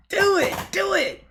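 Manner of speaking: loud, high chant of do it; shouted cheer. Retold or imitated. Egging on her friend's husband, a loud high cheer. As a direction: yell it like egging someone on.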